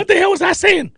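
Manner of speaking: flustered coach. Retold or imitated.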